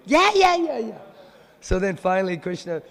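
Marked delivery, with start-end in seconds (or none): 0.1-1.0 very high, loud squeaky chorus of yeah; squealing friends urging Radharani on; pushy girlfriends. Acted. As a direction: egg her on like giddy teens. Girls urge her again.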